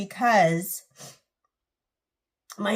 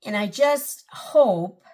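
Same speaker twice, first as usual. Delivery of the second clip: getting emotional; 3 dB louder, slower than usual. Real, not acted. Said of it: "I don't know why I'm getting emotional".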